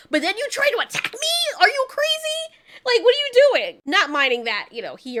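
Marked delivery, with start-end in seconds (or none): 0.1-3.8 indignant outburst. Retold or imitated. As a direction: pitch shoots up into an outraged, half-laughing cry. Range yelling at Spear.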